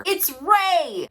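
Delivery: spring the twist on them; shocked reveal. Real, not acted. Big reveal in the mirror.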